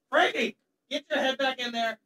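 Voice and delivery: distant pet scolding; off-mic dog command. Real, not acted. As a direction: order the dog back from a distance. Breaks off to order dog back.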